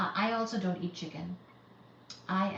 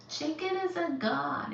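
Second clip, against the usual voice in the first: ordinary delivery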